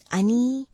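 "ani" drawn out slowly, with an added first vowel; wrong version. Shown as a demonstration. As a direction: stretch out a mistaken "ani". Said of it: "nothing like that".

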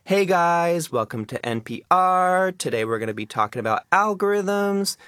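high, bright uptalk with lengthened words; lifestyle influencer accent. Shown as a demonstration. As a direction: do a YouTube lifestyle influencer intro. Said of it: "It has that up talk".

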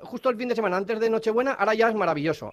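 high mocking sarcasm; mock her turnaround sarcastically; sarcastic. Prank caller mocking her change.

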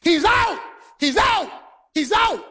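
high insistent yells; shouting he's out. Retold or imitated. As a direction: argue it like the visiting team protesting. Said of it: "the visiting team ran out".

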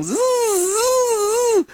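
high-pitched imitated reel whine; singing fishing reel. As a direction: buzz like line screaming off a reel. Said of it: "to hear the reel sing".

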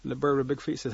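ordinary delivery

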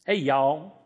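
light Southern drawl; Southern accent. Retold or imitated. Imagining someone from the South greeting people, Southern accent. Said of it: "someone's from the South".

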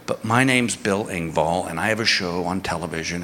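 embarrassed comedian whispering to a guard; sheepish name-drop. Retold or imitated. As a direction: reluctantly play the celebrity card, quietly.

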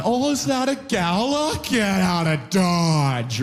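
high, slow mock-alarmed kid; kid's voice. Toddler near the cart, slow and high. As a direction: drag it out like a spooked kid.

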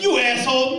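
loud, high yell at another driver; mock road-rage outburst; irate driver. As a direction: curse out someone who cut you off. Acted yelling at driver who cuts off.

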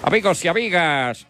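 bullring announcer calling the crowd. Acted. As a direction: call out to the whole arena. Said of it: "el animador dio".

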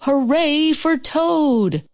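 high, drawn-out hooray; shouted cheer. A narrator's reading. Frog cheering from the theater, shouted hooray. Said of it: "as he shouted".

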